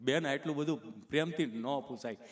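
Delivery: low, halting patient voice; tongue-tied Gabho. Retold or imitated. Gabho objecting to the nurse, low stammer. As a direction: labour through the sticking words. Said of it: "ચોંટે અટકે".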